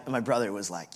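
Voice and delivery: ordinary talk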